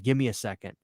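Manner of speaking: normal talking voice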